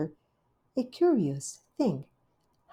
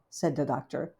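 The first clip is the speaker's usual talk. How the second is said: plain reading voice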